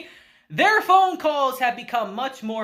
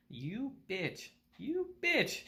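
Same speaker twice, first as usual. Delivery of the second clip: hushed, much softer aside; mock icy stare; 11 dB quieter, slower than usual. Horikita silently glaring at upbeat Kushida, softly voiced glare. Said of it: "Horikita just gives her the stare".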